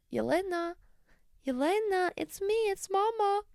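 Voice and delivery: high, soft imitation of a mother calling; film mother's voice. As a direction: softly act out the mother's tender plea. Re-enacts an emotional film scene.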